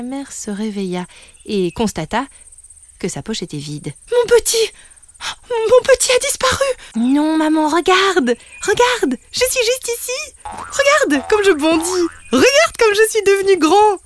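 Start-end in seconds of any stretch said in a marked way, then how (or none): 4.1-6.6 put-on high, loud panicked call for her lost child; panicked cry. Mother kangaroo wakes to empty pouch, panicked cry. 7.0-14.0 put-on high, loud eager child's voice; excited child. Young kangaroo shows his mother how big he is, excited.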